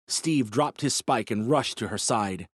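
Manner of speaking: ordinary delivery, nothing marked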